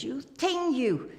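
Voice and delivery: high, mock-outraged scold. Scolding the colleague who ripped her tights, in mock outrage. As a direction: tease him back with fake outrage.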